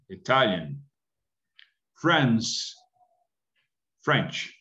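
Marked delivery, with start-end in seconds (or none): 0.1-0.8 "Italian" said the right way after the wrong one; correct Italian. Shown as a demonstration. Teacher gives students the right "Italian". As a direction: model the correct "Italian" again. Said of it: "Not Italian".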